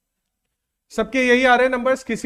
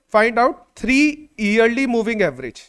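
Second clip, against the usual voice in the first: very slow, word by word, for writing down; slow dictation to the class; much slower than usual. Shown as a demonstration. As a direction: spell it out slowly so they can copy. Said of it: "मैं question लिखवा देता हूं".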